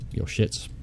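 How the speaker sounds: a whispered put-down; whispered insult. Real, not acted. Manager dismissing a useless player on the list, whispering. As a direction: hiss it under your breath, dismissive.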